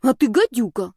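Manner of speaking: loud, emphatic insult; forceful, stinging comeback; cutting retort. A narrator's reading. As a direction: slam the name down to end the argument. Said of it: "припечатала".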